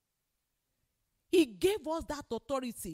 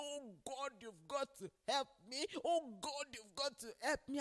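much softer, mildly pleading mock cry; imitated tearful plea; 11 dB quieter than usual. Preacher retells Kenneth Copeland crying to God for help, imitated tearful plea. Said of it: "he was crying and was saying".

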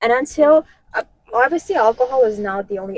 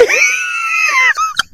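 a loud, high, disbelieving laugh; shrieking laugh; 7 dB louder than usual. Real, not acted. Laughing in shock at weeks of daily vodka.

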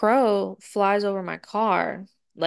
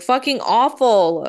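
louder, slow fed-up groan; exasperated; 4 semitones higher, 7 dB louder than usual. Retold or imitated. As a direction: drag it out, totally fed up. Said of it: "And I was like".

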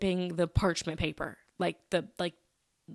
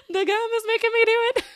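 higher, louder, laughing through the words; 6 dB louder than usual. Real, not acted. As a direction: giggle it out as you confess. Laughing at own gum sass.

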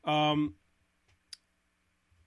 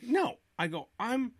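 angry refusal; 8 semitones higher, slower than usual. Retold or imitated. Fight with wife, retold.

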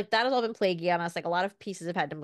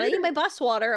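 lightly voiced toddler; 7 semitones higher, 5 dB louder than usual. Kid's demand retold.

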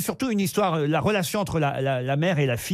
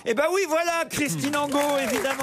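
much higher, excited game-show host; real triumphant confirmation; 9 semitones higher than usual. Real, not acted. Host confirms Lucie's winning answer, excited. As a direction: celebrate the right answer in a high, lifted voice.

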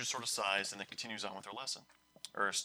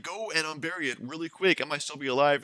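a bit higher, louder order; urgent teacher; 5 semitones higher, 8 dB louder than usual. Retold or imitated. Teacher ordering students to dig up the bird, urgent. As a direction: hurry them up. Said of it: "Doctor Ham's like".

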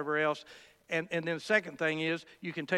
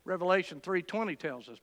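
ordinary talk, nothing marked

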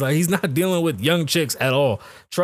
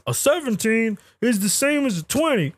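higher, mocking voice of the claim; mock quote; 6 semitones higher than usual. Host mocks an online claim, higher mocking quote. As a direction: parrot the silly claim. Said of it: "anybody serious that says".

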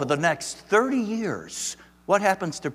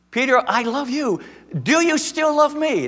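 pleading Jesus; 12 semitones higher, 6 dB louder than usual. Acted. Voicing Jesus pleading with Peter.

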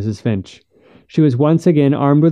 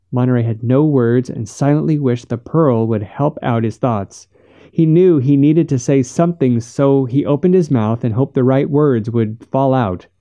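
ordinary delivery, nothing marked

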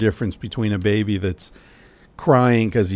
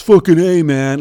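loud bro shout; 8 semitones higher, 9 dB louder than usual. Retold or imitated. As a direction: yell it like a rowdy American guy. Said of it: "like, shouts".

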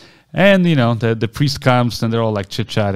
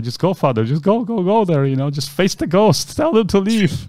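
pleading, higher-pitched push to go on; urgent urging; 8 semitones higher than usual. Retold or imitated. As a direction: egg someone on, voice rising as you go. Frightened group urging priest forward.

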